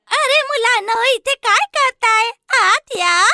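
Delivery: put-on high, slow old witch voice; sweet-talking witch. Witch luring the children into the cake house, high and slow. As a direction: lure them in, high and sugary.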